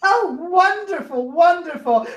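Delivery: high, giddy shriek of delight; gleeful creature voice. Acted. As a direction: squeal like a fiend finding treasure. GM voices excited Erinyes.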